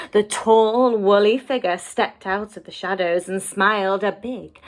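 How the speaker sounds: ordinary delivery, nothing marked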